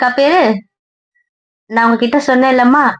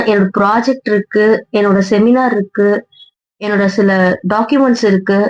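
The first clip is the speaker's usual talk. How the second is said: usual speaking voice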